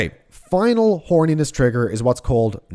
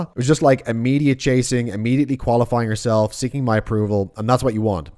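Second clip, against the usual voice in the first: ordinary talk, nothing marked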